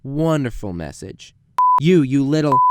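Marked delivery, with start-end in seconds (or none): 1.8-2.6 louder, put-on furious voice; loud furious caller; angry caller. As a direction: rage down the phone. Angry phone message.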